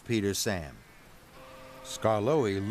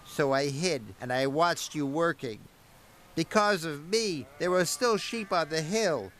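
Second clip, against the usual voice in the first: resolute engine; 5 semitones higher than usual. A narrator's reading. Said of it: "he chuffed".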